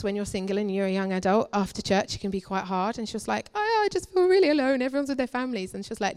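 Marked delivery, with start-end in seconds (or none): 3.5-5.7 high-pitched mock woe, easing down; self-pitying complaint. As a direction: imitate a friend feeling sorry for herself. Acting friend's lonely complaint.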